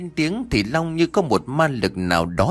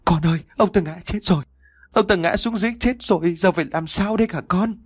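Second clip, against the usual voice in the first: high, hurried, distressed voice; panicked distress; 8 semitones higher, faster than usual. A narrator's reading. Mother tells Long his father is dead, panicked voice. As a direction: rush it out high, mildly panicking.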